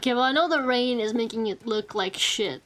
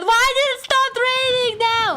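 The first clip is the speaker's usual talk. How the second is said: high, loud frustrated wail; angry crying; 7 dB louder than usual. Real, not acted. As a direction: shriek it out in real frustration. Rain ruins the climb.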